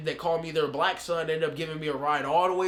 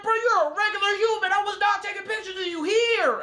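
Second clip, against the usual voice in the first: much higher, shouted, near a cry; indignant shout; 13 semitones higher, 4 dB louder than usual. Retold or imitated. To Quay who accused him of taking pictures, indignant shout. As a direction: protest loudly that you did nothing. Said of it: "I said".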